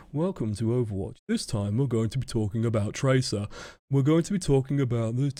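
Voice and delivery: imitating a nervous beginner's video intro; stiff, timid recited welcome; mock-timid, under-projected presenter. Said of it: "I sound scared, I sound timid, I sound afraid".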